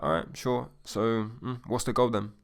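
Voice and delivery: understated, unemotional acknowledgement; blunt and unimpressed. Shown as a demonstration. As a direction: take it in quietly, as if routine. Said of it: "that bluntly, that silently".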